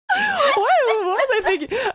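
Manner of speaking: squealed out through laughter, high and loud; real laughing disbelief, drawn out and high; helpless laughter. Real, not acted. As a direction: cry it out while cracking up. Laughing at own old script.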